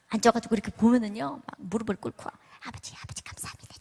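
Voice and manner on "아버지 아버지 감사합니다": imitating a kneeling mother murmuring to God; whispered prayer. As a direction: whisper the prayer under your breath. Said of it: "막 이러면서 기도하는데".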